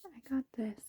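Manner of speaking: usual speaking voice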